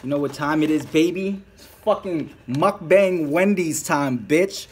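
loud, drawn-out hype call; hype intro. Real, not acted. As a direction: open the stream big and slow. Hyped mukbang intro.

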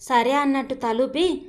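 plain reading voice

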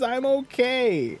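high, drawn-out put-on insistence; hammed-up high, slow protest; mock reassurance. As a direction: pitch it way up and stretch it. Protests after mock charity appeal.

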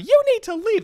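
very high mock shriek; screaming parents. Parents screaming at him to leave, high mock shriek. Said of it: "All the parents are screaming at me".

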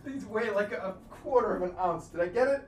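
ordinary talk

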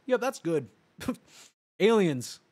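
usual speaking voice

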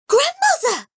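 high, loud call, struck by an idea; sudden exclamation. A narrator's reading. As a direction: blurt out the name as the thought hits. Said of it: "she suddenly exclaimed".

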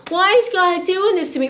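high, loud mock whine to God; whiny complaint. Preacher mocks a complainer blaming God, high whine. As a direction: imitate a believer whining at God.

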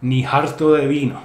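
harto with a breathy h sound; ni harto de vino, breathe the h in harto; aspirated h. Shown as a demonstration. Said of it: "a term to express repulsion".

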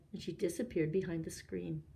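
plain reading voice

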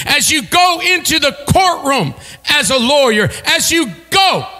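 rising, ringing preacher cadence; preaching. Acted. Preacher urging congregation into their workplaces, building preaching. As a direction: build the list toward the punch.

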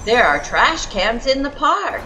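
plain reading voice

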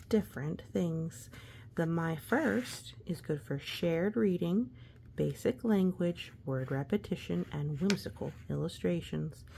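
plain reading voice

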